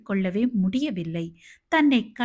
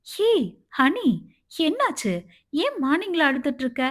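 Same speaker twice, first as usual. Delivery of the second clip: higher-pitched, alarmed questioning; flustered concern; 6 semitones higher than usual. A narrator's reading. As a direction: ask in a worried fluster. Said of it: "அழுவதை கண்டதும் பதறியவாறு". (பதறிய அக்கறை)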